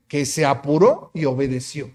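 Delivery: usual speaking voice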